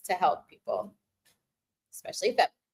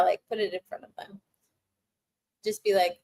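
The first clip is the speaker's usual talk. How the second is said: normal talking voice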